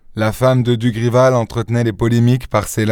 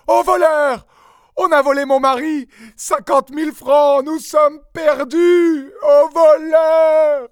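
crie très aigu, lent, en pleurs; hurlements; slower than usual. Acted. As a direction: hurle à l'aide, désespérée. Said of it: "de véritables hurlements". (épouse qui hurle au voleur en sanglotant)

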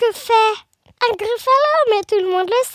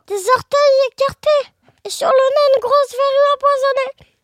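effroi joué; 5 semitones higher, 6 dB louder than usual. Acted. Mouse scared describing the monster.

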